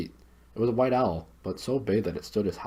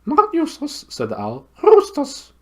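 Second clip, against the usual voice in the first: very high owl voice, hooted loudly; high, loud owl call; loud hooting owl; 10 dB louder than usual. Acted. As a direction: hoot it as loud as you can.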